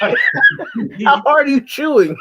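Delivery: high, loud laughing tease; laughing question. Real, not acted. Teasing him about chewing, laughing loud. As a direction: laugh through it while teasing.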